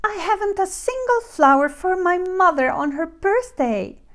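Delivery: put-on high little girl lament; dismayed Goldilocks. A narrator's reading. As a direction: wail it mildly as Goldilocks. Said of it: "she cried".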